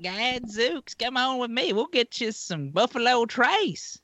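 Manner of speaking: Gomer Pyle. Retold or imitated. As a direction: coo it like a goofy country boy. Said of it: "Gomer Pyle".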